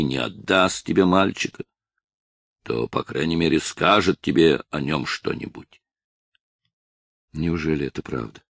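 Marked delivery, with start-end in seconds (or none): none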